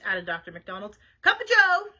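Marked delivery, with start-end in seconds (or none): 1.2-1.9 high, loud waitress holler; short order yelled out. Acted. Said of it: "hollered the waitress".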